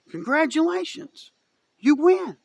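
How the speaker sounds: high-pitched mock cheer; sarcastic congratulations. Real, not acted. Vlogger to Democrats who got their way, sarcastically celebratory. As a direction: congratulate them with biting sarcasm.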